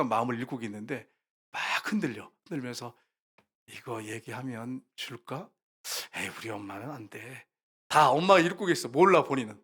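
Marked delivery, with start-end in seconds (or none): 3.7-7.5 put-on child's inner voice. Child muttering, deciding not to ask.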